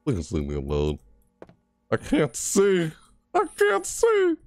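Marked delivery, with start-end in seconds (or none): 1.9-2.9 slow, high-pitched complaint; dismayed complaint. Real, not acted. Player with lights messed with, dismayed. Lights failing in horror game. 3.3-4.4 slow, very high wail; desperate wail. Real, not acted. Player with lights messed with, desperate wail.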